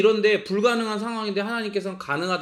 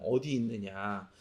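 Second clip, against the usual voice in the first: voice of God; 10 semitones lower, 9 dB quieter, slower than usual. Acted. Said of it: "이게 신적인 질문이라고 그랬잖아요".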